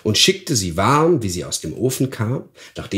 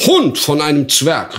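louder, higher furious cry; the duke explodes at his cook; angry shout; 5 semitones higher, 5 dB louder than usual. Acted. Duke shouts at his dwarf in fury. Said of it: "rief er".

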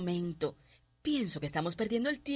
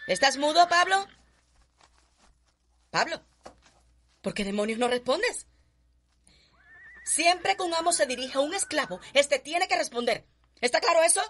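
loud, higher, commanding rebuke to a servant; angry scolding; 4 semitones higher, 5 dB louder than usual. Acted. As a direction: bark orders at the slave who won't answer. Mistress scolding silent slave. (regaño furioso y autoritario)

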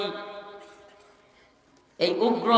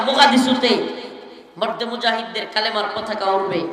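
preaching at full force; 6 dB louder than usual. Acted. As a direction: proclaim the coming victories to the crowd. Sermon prophecy of coming victory. (চড়া গলায় জোশের ঘোষণা)

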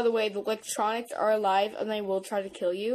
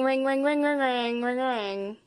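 phone ring imitation; 2 semitones higher, 5 dB louder, slower than usual. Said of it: "This is a phone ringing".

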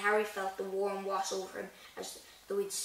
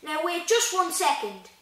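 slow, loud outburst, pitched up; thundered; 8 semitones higher, 9 dB louder than usual. A narrator's reading. Hagrid outraged, halting the talk, thundering. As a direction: halt everyone with a slow shout. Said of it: "Hagrid thundered".

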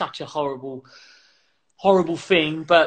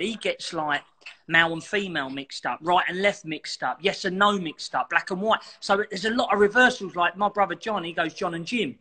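ordinary talk

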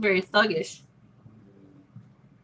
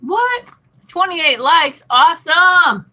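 high, loud, delighted exclamation; excited surprise; 7 semitones higher, 9 dB louder, slower than usual. Real, not acted. Streamer reacting to a jump in likes, excited and surprised. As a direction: burst out excitedly, louder and higher.

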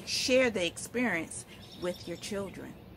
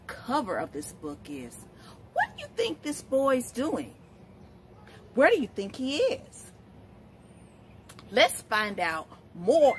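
put-on reading to children; 3 semitones higher than usual. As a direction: read it like story time on the rug. Reads picture book to children.

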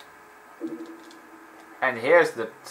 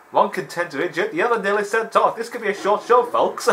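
high, lively commentator voice; mock TV commentator; 9 semitones higher than usual. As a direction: announce it like a sports host. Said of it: "It was like".

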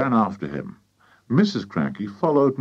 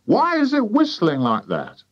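high, querulous question; querulous farmer's wife; 12 semitones higher, 3 dB louder than usual. A narrator's reading. Wife hearing the hen whistle, high querulous question. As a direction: ask it high and suspicious.

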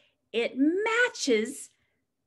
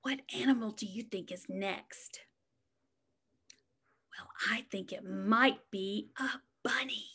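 whisper; 4 semitones lower, 10 dB quieter, faster than usual. Acted. Guessing next animal with suspense.

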